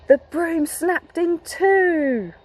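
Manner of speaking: dramatic storytelling. Acted. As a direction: stretch it out for suspense. Dramatic story climax read aloud.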